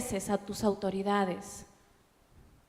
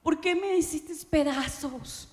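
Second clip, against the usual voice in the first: tearful plea; 6 semitones higher than usual. Retold or imitated. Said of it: "Y lloraba y le decía".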